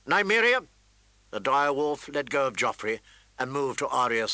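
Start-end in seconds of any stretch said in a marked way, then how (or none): 0.1-0.7 higher, louder command; sharp command. Acted. Arya sharply calling her wolf off the prince. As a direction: crack the name out like a whip. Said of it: "Arya's voice cracked like a whip".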